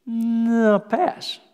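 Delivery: picky dismissal. Acted. Preacher acting out someone picking through scripture, dismissive. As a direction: hum and haw up high, then lightly turn it down. Acts out someone rejecting a Bible verse.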